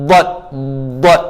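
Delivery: "Dad, dad" each pressed and bounced off the cheek; dad from tongue side. Shown as a demonstration. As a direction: demonstrate a rebounding "dad". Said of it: "Belah sini pula". (sebut "dad" dari tepi lidah, memantul)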